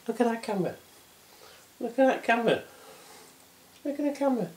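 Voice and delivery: very high cooing voice to the cat; high pet talk. Real, not acted. Man coaxing his cat to look at the camera, very high voice. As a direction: coax the cat sweetly, way up high.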